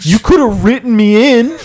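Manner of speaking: high, wounded protest; hammed-up hurt without a character voice; out-of-character complaint. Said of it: "Sorry, I'm not doing the Madara voice".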